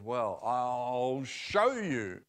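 slow, drawn-out Apple voice; Apple's voice. Acted. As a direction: let Apple take its time, a touch otherworldly. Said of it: "So Apple goes".

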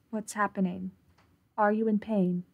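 usual speaking voice

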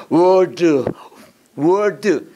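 put-on "word" in an overdone, forced English accent; "word" unnaturally drawn out, parodying odd pronunciation; mock unnatural pronunciation. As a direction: imitate someone straining to sound English on "word". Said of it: "꼭 영어만 하면 발음 이상한 사람 있어요".

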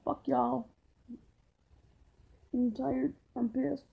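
put-on droop every word like a moody emo kid; emo person. Vlogger greeting viewers in a sullen emo voice. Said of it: "I was acting like an emo person".

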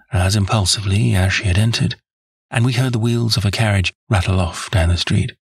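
usual speaking voice

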